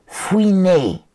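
créature aiguë et rancunière; lâcher le mot d'une voix haut perchée; Gollum. Acted. (Gollum, voix de créature aiguë)

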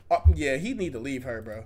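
a hint of a dismissive mocking impression; mocking imitation. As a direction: parody her in a low, put-on voice. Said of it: "she still talking about".

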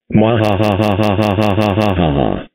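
slow evil cackle; villainous mwahaha, drawn out; mad scientist laugh. Acted. Villain plotting a prank.

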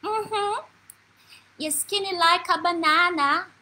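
put-on high, slow child's voice; imitated little grandniece. Five-year-old grandniece answering her offended aunt, high slow child's voice. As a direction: stretch it out like a small girl confirming. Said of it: "She said".

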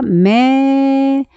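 메 with the vowel held long; slow blending. Shown as a demonstration. Teacher adds m to the vowel, 메 drawn out. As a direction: blend m into a stretched 메. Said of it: "미음 넣으니까".

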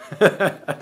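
laugh. Real, not acted. Joke lands at neurologist.

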